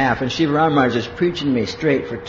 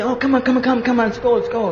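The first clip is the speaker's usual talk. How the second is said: higher-pitched, insistent urging; coaxing friends; 6 semitones higher, faster than usual. Retold or imitated. Friends urging him to come out, coaxing. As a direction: mimic pals pleading and pestering.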